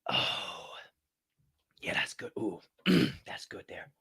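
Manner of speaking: breathy whisper of pleasure; whispered savoring. Real, not acted. Host savors coffee in a whisper. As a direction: savor it under your breath.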